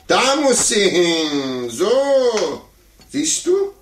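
slow triumphant crow, climbing in pitch. Acted. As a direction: gloat over the winning move, slow and loud. Triumphant chess move against child.

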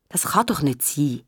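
usual speaking voice

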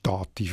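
Dativ stressed on DA-; accent the first syllable of Dativ; stress moved forward. Shown as a demonstration. Editor showing the German result, Dativ stressed on DA-. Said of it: "die Betonung vorgerutscht ist".

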